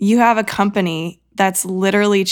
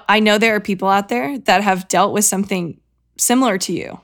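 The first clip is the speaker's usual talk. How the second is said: usual speaking voice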